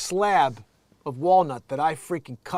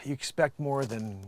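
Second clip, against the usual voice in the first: usual speaking voice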